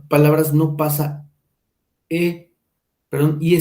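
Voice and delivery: ordinary talk, nothing marked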